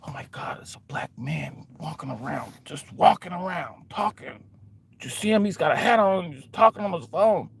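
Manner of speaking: breathless whispered gossip. Retold or imitated. Bystanders gossip about a man in a hat, whispering. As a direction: whisper it to a friend like it's scandal.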